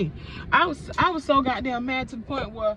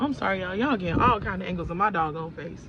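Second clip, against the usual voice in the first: ordinary talk, nothing marked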